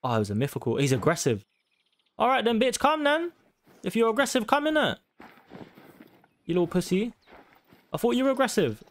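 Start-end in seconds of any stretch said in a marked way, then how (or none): 2.2-8.8 high-pitched mocking taunts at the croc; taunting. Real, not acted. Hunter taunting a charging crocodile in the game, high mocking goad. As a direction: goad it to come at you.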